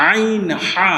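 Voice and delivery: আইন হা said from the middle of the throat. Shown as a demonstration. Teacher showing pupils where আইন হা is voiced. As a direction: squeeze আইন হা out of the mid-throat. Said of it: "হলকের মধ্যখান হইতে".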